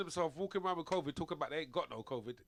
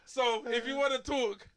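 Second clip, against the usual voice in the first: laughing through a quote; 6 semitones higher, 8 dB louder, slower than usual. Retold or imitated. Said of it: "He's like".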